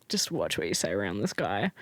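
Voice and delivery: low, quiet aside; hushed warning. Retold or imitated.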